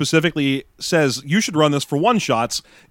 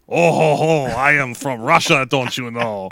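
bad Russian accent with a chuckle. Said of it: "Now for my Russian accent".

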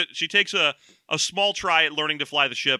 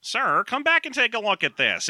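high-pitched stormtrooper put-on; 7 semitones higher than usual. Stormtrooper calling the officer back to look, in a higher trooper voice. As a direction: play a trooper calling up to a superior. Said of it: "do we still do Stormtrooper voice".

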